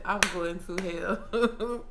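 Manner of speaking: slow, laughing confession; guilty laugh through it. Real, not acted. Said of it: "laughing confession".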